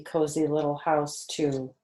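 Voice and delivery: plain reading voice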